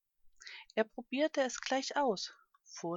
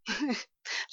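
short acted chuckle; ostrich's chuckle. A narrator's reading. Ostrich amused by heavy elephants flying, chuckling. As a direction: chuckle briefly in character. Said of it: "lachte der Strauß".

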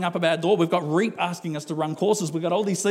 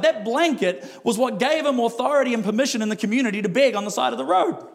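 ordinary delivery

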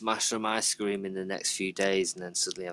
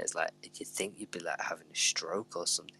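whispering; 2 semitones lower, 7 dB quieter than usual. Real, not acted. Whispered, unvoiced.

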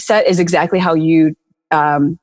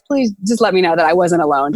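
ordinary delivery

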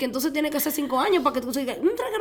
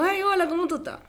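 high-pitched mock-casual hello; put-on chirpy greeting; playful greeting; 8 semitones higher than usual. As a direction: act out an eager hello, pitched well up. Acting out her message to him.

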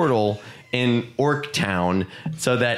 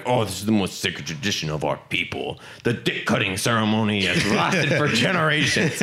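mock solemn orc; 5 semitones lower than usual. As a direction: intone it like a sacred rite. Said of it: "And like".

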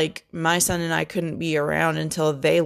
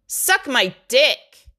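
slow, higher and louder, every word hammered; mock telling-off; 7 semitones higher, 4 dB louder, much slower than usual. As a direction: spell it out slowly and hard at the ex. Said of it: "how bad I wanted to be like".

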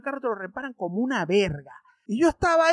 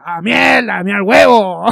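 loud mock outburst as the uncle; imitating my uncle; 12 dB louder than usual. Teasing cousin by imitating the uncle, loud mock outburst. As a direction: blurt it out loudly like the shocked uncle. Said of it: "yo nada más que decía".

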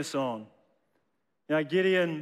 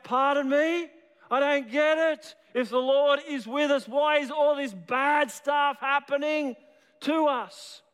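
higher, pleading, baffled voice; Gideon's polite protest; 7 semitones higher than usual. Acted. Preacher voicing Gideon questioning God, higher and bewildered. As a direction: ask it high and bewildered but polite.

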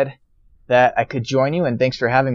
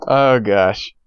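usual speaking voice

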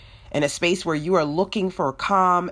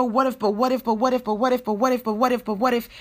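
mock fretting, higher and looping; worried inner voice; 6 semitones higher, much faster than usual. Speaker voicing Satan's worry loop, mock anxious and higher. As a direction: spiral through the what-ifs anxiously. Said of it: "Satan worries you".

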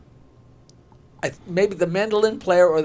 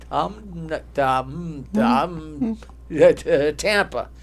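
acted stutter, stuck on the first syllable; much slower than usual. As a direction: keep retrying the opening sound until the word comes out. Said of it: "they're all stuttering".

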